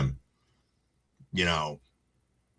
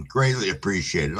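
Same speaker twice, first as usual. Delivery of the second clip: usual speaking voice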